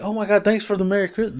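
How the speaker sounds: high, soft delighted surprise; imitating touched, surprised riders; retold surprised thanks. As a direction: pitch it up in light amazement. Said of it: "you'd be surprised".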